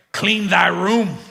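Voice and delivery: slow, loud parental decree in King James style; mock biblical command. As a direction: proclaim it with mock grandeur, drawn out. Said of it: "start using that on my kids".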